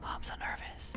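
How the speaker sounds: hushed and tense, no voice; nervous whisper. Real, not acted. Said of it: "I'm whispering".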